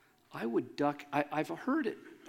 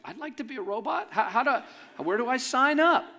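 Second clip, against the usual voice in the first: laughing, building to a louder, higher eager ask; eager mock volunteering; 9 semitones higher, 4 dB louder than usual. Preacher jokingly volunteering to be a robot, laughing and eager. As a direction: play it like a kid begging to join. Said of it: "I'm like".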